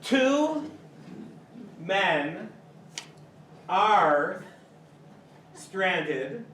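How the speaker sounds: slow, one word at a time; long pauses between the words; deliberate joke setup. Real, not acted. Joke teller opening on two stranded men, halting and deliberate.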